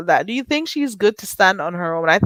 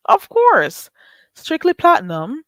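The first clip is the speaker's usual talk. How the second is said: English accent; 8 semitones higher than usual. Shown as a demonstration. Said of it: "Let me try it".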